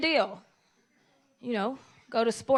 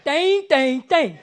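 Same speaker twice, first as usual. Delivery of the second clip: laughing mimicry; 3 semitones higher, 11 dB louder, much slower than usual. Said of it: "They be like".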